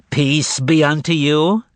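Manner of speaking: slow nasal greeting from outside. A narrator's reading. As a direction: push it through the nose, slow. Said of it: "a nasal voice was heard".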